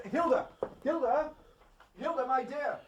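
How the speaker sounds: high, excited calling of his wife's name; eager calling. Acted. Prime Minister hurrying to his wife, calling her name excitedly. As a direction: call out to her with overjoyed relief.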